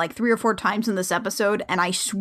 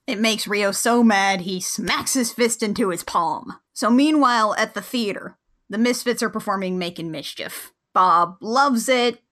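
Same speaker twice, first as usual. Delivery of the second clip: ordinary delivery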